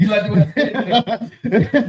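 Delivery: asked through a laugh. Real, not acted. Host to his co-host, laughing at his own line. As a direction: tease the friend while laughing. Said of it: "That was disgusting".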